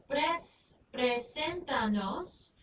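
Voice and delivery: preséntanos drawn out slowly, stress on SEN; slow syllable by syllable. Shown as a demonstration. Teacher to class, slow careful preséntanos. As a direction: spell out pres-, then preséntanos slowly. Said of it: "if we look at our command here".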